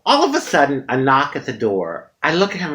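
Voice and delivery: ordinary delivery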